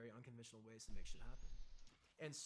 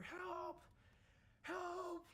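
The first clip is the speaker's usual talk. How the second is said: high, loud desperate yelling for help; panicked cry for help; 4 dB louder than usual. Retold or imitated. Recruit in the pool yelling for help, panicked. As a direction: scream for help like you're going under.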